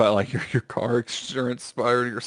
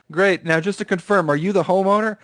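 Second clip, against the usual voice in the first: imitated scam caller; 6 semitones higher, 3 dB louder than usual.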